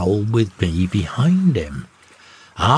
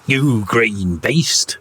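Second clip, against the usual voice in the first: slow, drawn-out angry yell; mate yelling; much slower than usual. A narrator's reading. Second mate yells at the parrot, slow angry shout. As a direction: spit it at the bird. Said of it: "yells the mate".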